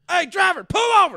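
hammed-up panicked yell to the driver; mock panicked yell. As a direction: yell it like a scared passenger. Panicked passenger yells at driver.

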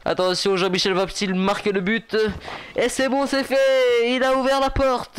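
high, excited play-by-play building to a loud call; mock sports commentary. Player commentates his own escape like a match, excited mock commentary. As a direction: play the commentator as the goal goes in.

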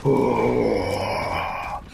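low, breathy dramatic exhale; exaggerated heavy sigh; heavy breathing. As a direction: breathe hard for dramatic effect. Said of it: "why are you breathing so hard".

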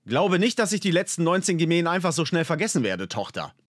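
father sternly reproaching his daughter. A narrator's reading. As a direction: scold her firmly. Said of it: "sagte Larias Vater streng".

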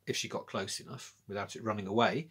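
ordinary delivery, nothing marked

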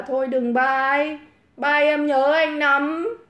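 slow, drawn-out mock plea; mock pleading. Streamer teasing a viewer not to leave, mock pleading. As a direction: stretch each word as a teasing plea.

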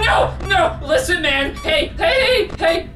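high, frantic bargaining; panicked screaming. Real, not acted. Player talking down the creature, panicked high pleading. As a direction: plead urgently at a high pitch. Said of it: "because I'm screaming".

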